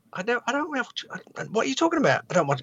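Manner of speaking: baffled reply. Retold or imitated. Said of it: "And I was like".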